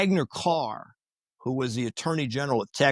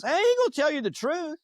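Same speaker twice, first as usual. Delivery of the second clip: much higher-pitched, amused quote; amused retort; 13 semitones higher than usual. Retold or imitated. Dulles reacting to plan to question Hoover, amused. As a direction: toss it out high, as if tickled. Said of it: "slaps the table and starts laughing".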